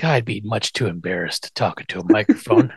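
lightly acted low-voiced demurral; lightly voiced Herman. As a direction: play a shy refusal, low. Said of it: "Well, Herman, he's like".

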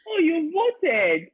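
high, slow, drawn-out surprise; surprised. Real, not acted. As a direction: sound truly taken aback. Surprised reply to friend.

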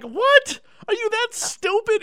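high, loud disbelieving squeal; incredulous. Retold or imitated. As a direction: shriek it in total disbelief. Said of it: "I'm like".

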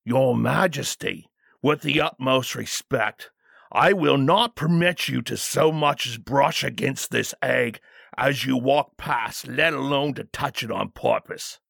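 deliberate, respectful royal guard's refusal; firm bodyguard. Acted. Bodyguard refusing to let the king near the egg, firm.

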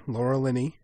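normal talking voice

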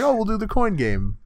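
high, chirpy mock butler voice; a cheeky high butler showing off; hammed-up butler. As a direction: play the smug butler up high. Acting out the butler's coin game.

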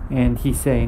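ordinary delivery

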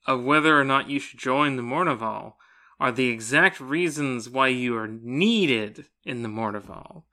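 ordinary talk

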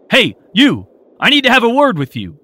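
high, loud call down the alley; shout. A narrator's reading. Officer calling to boy in alley, loud shout. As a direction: yell after him. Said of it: "he shouted".

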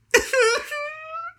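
high wailing sob. Acted. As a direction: let out a sudden wailing sob. Hazel breaks down crying.

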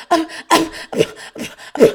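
loud, choked stammering noises; sputtering stutter. Retold or imitated. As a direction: imitate flustered spitting and stuttering. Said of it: "So I'm stuttering. I'm, like, spitting".

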